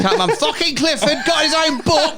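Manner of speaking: hammed-up Cockney bloke; Cockney accent, laughing. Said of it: "I don't know why I made you Cockney".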